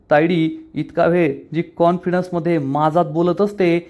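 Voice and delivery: usual speaking voice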